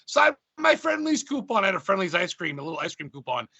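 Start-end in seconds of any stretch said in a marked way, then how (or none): 0.1-1.6 much higher, slow and a bit louder; high, slow, nervous young fan asking for an autograph; mock meek fan voice. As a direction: squeak it out like a starstruck kid at the door. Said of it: "I go".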